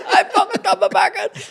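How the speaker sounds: very high, loud hammed-up wail; mock pained wail. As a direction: wail like an injured mate, half laughing. Acts out Pee Wee crying in pain.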